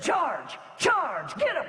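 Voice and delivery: high-pitched acted charge command; rally the men, pitch leaping on the last words; battle cry. Commander on the wall sends his men downhill, high battle cry.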